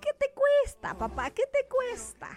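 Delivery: put-on high, childlike wheedling; childish pleading. Daughter teasing God to heal her, high childlike plea. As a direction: beg daddy like a spoiled little girl. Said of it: "de hija consentida".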